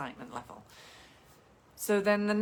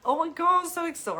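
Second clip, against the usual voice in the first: much higher and louder, squealing with glee; over-the-top excitement; 11 semitones higher, 6 dB louder than usual. Acted. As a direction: burst out like you just heard big news. Excited reaction to first grandchild news.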